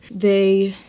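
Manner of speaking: breathless, winded restart; short of breath. Real, not acted. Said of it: "sorry, I'm so out of breath".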